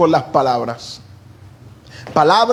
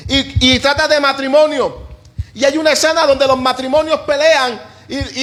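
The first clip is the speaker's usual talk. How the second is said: fiery preaching; 11 semitones higher than usual. Acted. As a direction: preach it to the congregation with fire. Preaching at full force. (predicación enérgica y apasionada)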